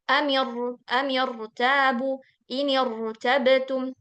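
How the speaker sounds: Amir, amirtabu dibaca sangat lambat dengan ro tebal; slow tajwid demo. Shown as a demonstration. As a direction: eja amirtabu pelan-pelan. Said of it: "ini khusus hamzah wasal". (inirtabtum dibaca lambat, ro tebal)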